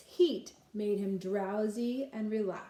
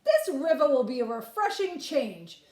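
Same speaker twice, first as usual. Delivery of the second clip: put-on cocky boy; 5 semitones higher, 6 dB louder than usual. Boy boasts to the fox.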